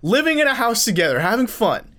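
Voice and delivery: high, hyped-up pitchman voice; over eager infomercial. Pretend. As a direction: sell it like a late-night TV ad. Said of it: "I wanted an infomercial voice".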